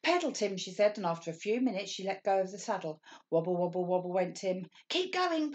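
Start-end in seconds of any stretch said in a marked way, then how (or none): 0.0-0.6 urging. Acted. Mummy urging Tim on. 4.9-5.5 much higher, a touch out of breath; puffed. Acted. Mummy running beside Tim's bike, higher puffing encouragement. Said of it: "puffed Mummy".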